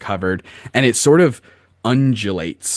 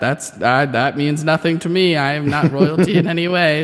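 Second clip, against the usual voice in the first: put-on old hag; 5 semitones higher than usual. Crone denies royalty.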